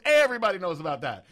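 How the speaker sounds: loud, high gleeful imitation; Keith Williams. Big guy revealing the gossip is out, gleeful. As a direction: crow it loudly, acted amusement. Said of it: "he starts hysterically laughing".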